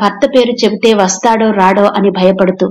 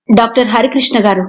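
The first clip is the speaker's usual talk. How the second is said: ordinary delivery, nothing marked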